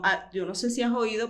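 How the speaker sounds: usual speaking voice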